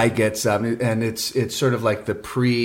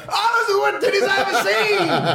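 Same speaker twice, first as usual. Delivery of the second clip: appalled hype man. Retold or imitated. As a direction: howl it in mock horror. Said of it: "To hear this guy go".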